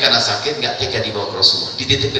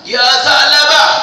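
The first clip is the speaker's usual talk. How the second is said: long, drawn-out call from far off; loud call; 11 dB louder, much slower than usual. Acted. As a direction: call his name across the hills. Umar calls out to Tha'labah. (seruan keras dan panjang)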